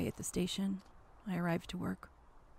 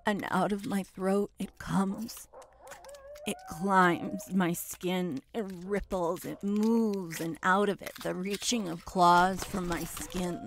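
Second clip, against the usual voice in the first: building intensity; 5 semitones higher, 12 dB louder than usual. Acted. Climax builds louder.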